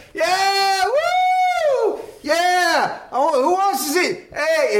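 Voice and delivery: high-pitched crowd-pumping yell; hype man. Retold or imitated. Emcee to concert crowd before [name], hyped. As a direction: whoop up the crowd as an excited emcee. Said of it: "pumping up the crowd".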